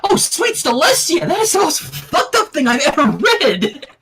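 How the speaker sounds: high voice breaking into laughter; laughing. A narrator's reading. Sunset reading the card, high and laughing. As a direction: laugh through the words. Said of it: "burst out laughing".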